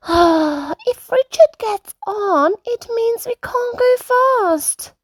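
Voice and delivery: a small sulky sigh of complaint; sighing Peppa. Acted. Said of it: "Peppa sighs".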